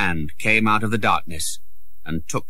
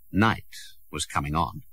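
ordinary delivery, nothing marked

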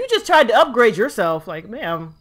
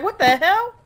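mock bafflement; 9 semitones higher, 4 dB louder than usual. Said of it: "I'm just sitting here like".

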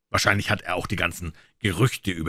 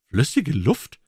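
higher, louder incredulous cry; astonished exclamation; 8 semitones higher, 5 dB louder, slower than usual. A narrator's reading. Mister Fair hearing of liquid air, astonished cry. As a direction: call it out in disbelief. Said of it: "rief Mister Fair".

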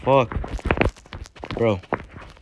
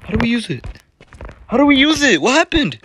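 high excited yelling; 10 semitones higher than usual. Real, not acted. Confused excited about scooter.

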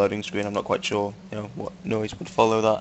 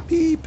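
a high-pitched imitation car beep; mock beep. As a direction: chirp Beep like a horn.

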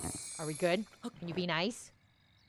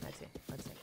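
whisper; 14 dB quieter, faster than usual. Real, not acted. Whispered to self.

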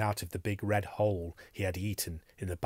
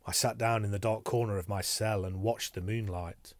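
ordinary delivery

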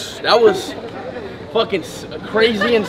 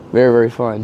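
ordinary talk, nothing marked

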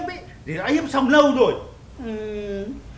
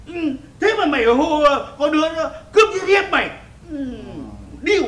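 ordinary talk, nothing marked

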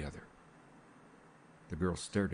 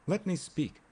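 higher, cutting in over the other man; insistent raised interruption; 10 semitones higher than usual. A narrator's reading. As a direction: demand the floor, pitched up. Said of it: "raising his voice".

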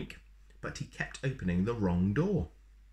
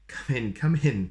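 cheery welcome; 5 semitones higher, 7 dB louder than usual. A narrator's reading. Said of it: "said Mister Wrong".

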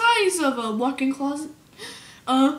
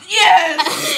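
loud, raised, stretched yes; exasperated yes; 4 semitones higher, 6 dB louder than usual. Acted. Sal to stalling interviewer, exasperated. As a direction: snap back loudly, fed up.

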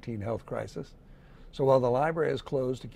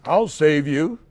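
a bright heroic answer, louder; chiming reply; 2 semitones higher, 7 dB louder than usual. A narrator's reading. Unicorn answering his own game, chiming back. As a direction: chime back like the rescuer. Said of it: "he'd chime back".

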